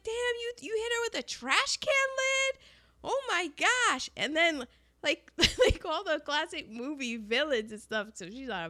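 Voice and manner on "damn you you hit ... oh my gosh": high-pitched mock disbelief; imitated scandalized amazement; astonished. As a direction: exclaim like stunned onlookers, pitched way up. Onlookers shocked at the fight.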